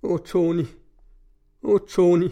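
a mild, understated cry of pain; pained moan. A narrator's reading. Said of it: "i dyb smerte stønnede".

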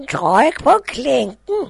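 high, loud granny call; old grandmother calling out. Acted. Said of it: "råbte bedstemoren".

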